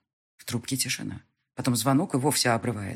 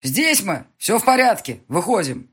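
calling out; 6 semitones higher, 7 dB louder than usual. Acted. Hero calling out to team.

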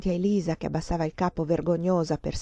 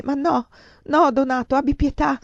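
distressed, higher, louder imploring; desperate sobbing; 7 semitones higher, 4 dB louder than usual. A narrator's reading. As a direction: beg desperately for pity. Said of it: "Io singhiozzavo disperatamente".

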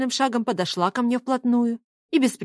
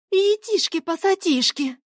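high, drawn-out startled exclamation; startled yelp; 10 semitones higher, slower than usual. Acted. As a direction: cry out as if caught off guard. Girl startled by whisper, leaps away. (испуганный высокий возглас)

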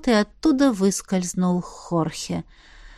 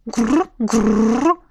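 dragon growl. Acted. Драконица рычит. (драконье рычание)

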